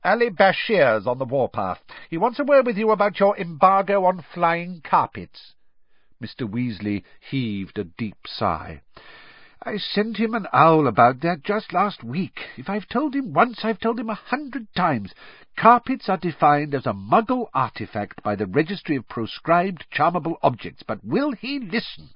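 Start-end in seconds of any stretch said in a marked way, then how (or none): 0.0-5.5 higher, insistent official's voice; brisk Crouch. Acted. Crouch warning Weasley about a carpet complaint, briskly. 9.6-22.1 high-pitched, put-upon character voice; exasperated Weasley. Acted. Weasley grumbling to Crouch about the carpet trader, exasperated.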